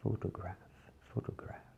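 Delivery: Photograph whispered almost without voice; quiet demo for silent practice; whispered model word. Shown as a demonstration. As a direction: breathe Photograph out very softly. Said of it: "Leiam baixinho".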